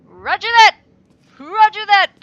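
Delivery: loud, high barked military acknowledgement; hammed-up army reply; mock soldier. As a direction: play the eager soldier.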